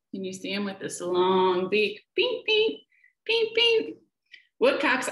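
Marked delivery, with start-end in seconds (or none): 2.2-4.0 very high pinging bird call; ping it out sharp and high; tiny high peep; woodcock call. A narrator's reading. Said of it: "This woodcock calls".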